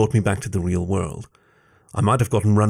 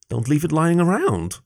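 higher-pitched, indignant comeback; shot-back retort; 7 semitones higher than usual. A narrator's reading. Fox answers Jason's underwear complaint, shooting back. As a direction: retort quickly with rising indignation. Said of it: "I shot back".

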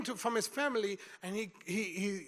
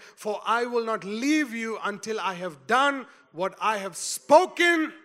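louder, rising sermon read of scripture; full-force preaching; 2 semitones higher, 9 dB louder than usual. Acted. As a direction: proclaim it to the congregation, building up.

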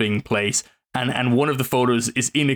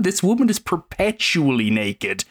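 normal talking voice

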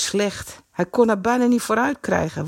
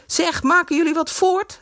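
high, raised impatient shout; impatient call; 11 semitones higher than usual. A narrator's reading. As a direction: hurry them along. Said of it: "riep Henk ongeduldig".

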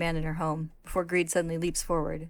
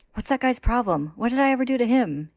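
panicked, higher and louder running for his life; frantic; 7 semitones higher, 7 dB louder than usual. A narrator's reading. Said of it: "he wonders frantically".

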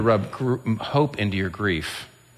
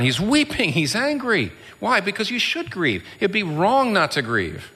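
higher-pitched, insistent preacher; impassioned preaching; 12 semitones higher than usual. Real, not acted. Preacher insisting Jesus wept and was angry, impassioned. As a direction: drive each short phrase home.

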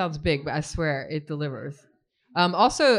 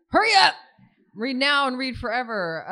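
high, loud playful shout; mock urgent call; 6 semitones higher, 4 dB louder than usual. Host jokingly hurries the audience to read, mock urgent call.

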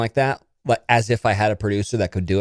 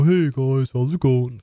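dopey dog voice; slower than usual. Retold or imitated. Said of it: "Kinda dopey".

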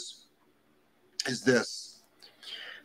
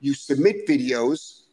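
ordinary talk, nothing marked

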